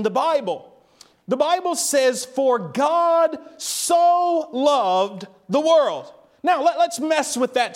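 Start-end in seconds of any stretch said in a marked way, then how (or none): 2.3-6.1 "so" drawn out slowly before loved; slow verse, leaning long on "so"; stress on so. Shown as a demonstration. Preacher quotes the verse to the church, stress on so. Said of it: "the word "so" intensifies the meaning".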